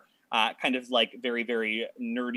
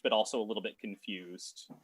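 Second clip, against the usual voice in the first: ordinary delivery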